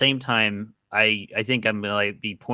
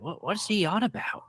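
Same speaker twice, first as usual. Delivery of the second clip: high-pitched baffled question; puzzled character; 10 semitones higher, 5 dB quieter than usual. Acted. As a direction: ask it in a high, put-on voice. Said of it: "I was like".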